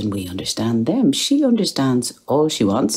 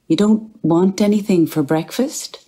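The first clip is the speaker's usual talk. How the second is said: calling out; 8 semitones higher, 4 dB louder than usual. Acted. Calling up to wife in bed.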